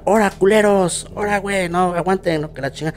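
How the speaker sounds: high-pitched mock yell without real volume; retold yell. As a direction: imitate someone hollering at pranksters, held back. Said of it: "Y empieza a gritar". (grito imitado, agudo y contenido)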